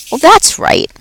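a jolly night janitor chatting to a pet; upbeat, chatty custodian; Aldo the cheerful janitor. Acted. Aldo answers.

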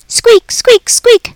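high squeaky squeak, squeak, squeak; three quick high-pitched rodent squeaks; hamster squeaks. Acted. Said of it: "I tried to tell him".

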